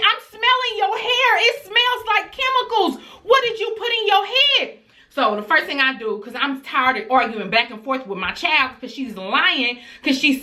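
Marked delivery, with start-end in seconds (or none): 0.0-4.8 angry mom. Retold or imitated. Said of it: "I said".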